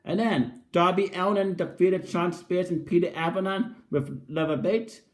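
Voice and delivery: ordinary talk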